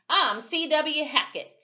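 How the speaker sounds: high, loud taunting name; mocking voice. Another man jeering at the sheriff with his name, high and loud. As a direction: toss it out as a jeer.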